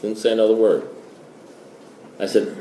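usual speaking voice

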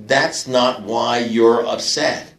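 slow, measured sponsor's correction; calmly acted, drawn-out pronouncement; deliberate verdict. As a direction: spell it out word by word, unhurried.

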